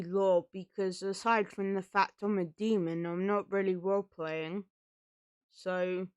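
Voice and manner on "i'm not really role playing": ordinary delivery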